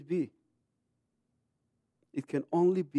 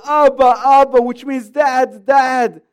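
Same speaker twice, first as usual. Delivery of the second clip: loud, higher pleading cry of Abba; imitating crying child; 8 semitones higher, 13 dB louder, slower than usual. Crying son calling to his father, loud pleading. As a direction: call out for daddy like a crying boy. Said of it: "he's crying and he says".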